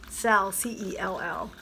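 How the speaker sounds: slow, letter-by-letter spelling of "C E L L"; spelled out. Shown as a demonstration. Clarifying the word for viewers, slowly spelling "C E L L". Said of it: "I don't want to oversell my paint".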